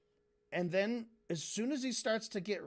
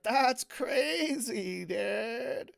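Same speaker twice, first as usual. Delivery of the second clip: very slow, higher California stoner drawl; 4 semitones higher, much slower than usual. Retold or imitated. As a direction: stretch every word like a stoner. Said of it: "do the stoner voice".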